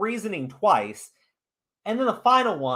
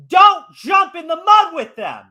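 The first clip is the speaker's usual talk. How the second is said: loud, slow warning; emphatic shout; 9 semitones higher, 10 dB louder, slower than usual. Real, not acted. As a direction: shout the lesson out slowly. Lecturing, driving home lesson.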